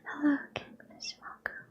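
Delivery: usual speaking voice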